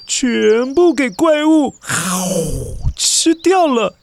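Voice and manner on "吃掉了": plain reading voice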